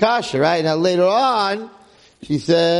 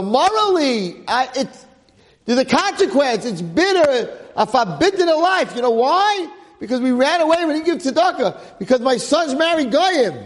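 high, pressing sermon climax; fiery preaching; 10 semitones higher than usual. Acted. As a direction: drive the moral home to the crowd. Preaching the lesson at full force.

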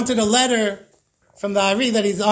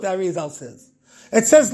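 ordinary talk, nothing marked

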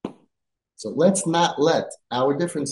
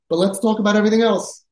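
higher, louder plea; emphatic plea; 8 semitones higher, 5 dB louder, faster than usual. Acted. Rabbi urging parents to talk of other things, emphatic. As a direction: push the point home.

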